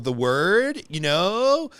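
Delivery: "word" and "know" swooping upward at the end; last-syllable overemphasis. Shown as a demonstration. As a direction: mimic Valley Girl uptalk, lifting "word" and "know" into questions. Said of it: "overemphasis on the last syllable".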